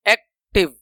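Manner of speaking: Active split as एक, pause, टिव; broken into syllables. Shown as a demonstration. Teacher showing the voice breaking between syllables, active split. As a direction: put a clear gap inside active. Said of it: "टूट रही है आवाज".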